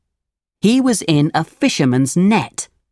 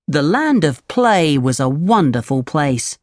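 ordinary delivery, nothing marked

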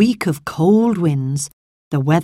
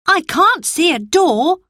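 put-on boy Colin; 12 semitones higher than usual. Colin speaks, high boyish voice.

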